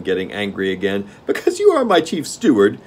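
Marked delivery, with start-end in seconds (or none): 1.3-2.8 gnome king. Acted. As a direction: relish the game like a spoiled monarch.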